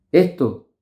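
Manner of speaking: ordinary talk, nothing marked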